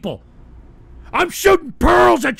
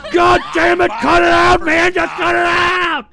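louder, higher angry yelling; angry shout; 5 semitones higher, 6 dB louder than usual. Real, not acted. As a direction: bark at them to stop. Yelling at chat.

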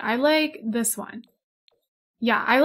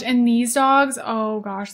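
ordinary talk

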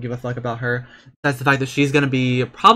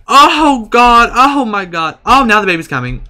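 excited yelling; 9 semitones higher, 10 dB louder than usual. Real, not acted. Excited about baby.

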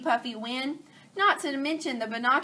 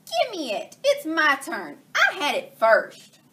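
squabbling pig; 6 semitones higher, 6 dB louder than usual. Acted. Pigs fighting over phone.

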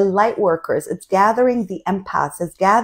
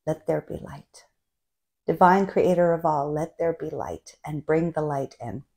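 much softer, lower prayerful mantra; whispered mantra; 4 semitones lower, 6 dB quieter than usual. Shown as a demonstration. She shows how to use the mantra when troubled, softly. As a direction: say it quietly like a private prayer. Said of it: "That's a cool mantra".

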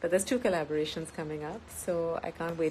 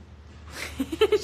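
high breathy giggle; 14 semitones higher, 4 dB louder than usual. Real, not acted. Mom waving, she giggles.

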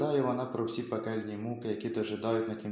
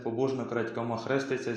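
usual speaking voice

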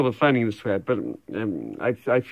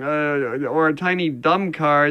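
ordinary talk

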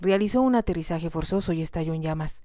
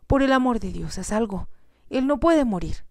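frantic plea; 6 semitones higher than usual. A narrator's reading. Jocelyn begs Morgan to save Terry, frantic. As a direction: beg him to act before it's too late. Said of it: "exclamó".

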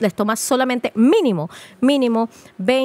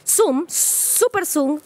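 slow mock zoom; 6 semitones higher, much slower than usual. Mocking porn close-ups.

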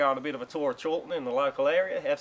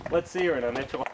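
ordinary delivery

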